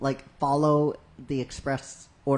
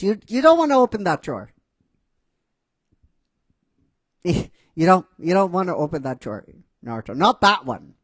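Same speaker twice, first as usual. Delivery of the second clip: panicked warning; 3 semitones higher, 6 dB louder, faster than usual. Retold or imitated. Naruto warns about drawer.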